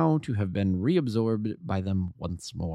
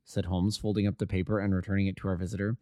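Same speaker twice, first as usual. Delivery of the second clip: ordinary delivery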